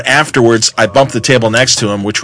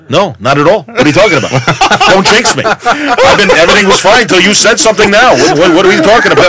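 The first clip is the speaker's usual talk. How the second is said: jokey panic; 7 semitones higher than usual. Retold or imitated. Editor denies any trouble, laughing then panicking.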